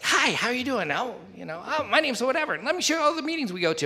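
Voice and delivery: high-pitched, over-friendly welcome; mock eager greeters. Imitating overeager greeters at a meeting.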